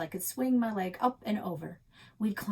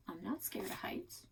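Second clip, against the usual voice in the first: unvoiced whisper; 10 dB quieter than usual. A narrator's reading. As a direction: breathe it out in a whisper.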